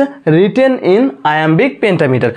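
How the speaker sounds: slowly repeated, words stretched apart; slow dictation. Shown as a demonstration. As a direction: say it again slowly so the class can write. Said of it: "দেখেন কি বলেছে".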